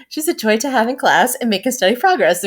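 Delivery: teacher report voice. Retold or imitated. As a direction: parrot the stock report-card line in a sing-song teacher voice. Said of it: "She's like".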